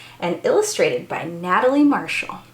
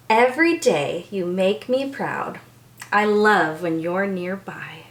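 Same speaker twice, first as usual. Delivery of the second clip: gentle storybook reading; reading to children. Acted. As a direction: bedtime picture-book voice. Reads picture book aloud.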